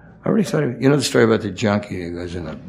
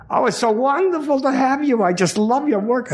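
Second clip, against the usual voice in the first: much higher, gushing woman's voice; gushing directress. Retold or imitated. Directress welcomes the actor on set, high and gushing. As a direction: play the fawning directress, way up high.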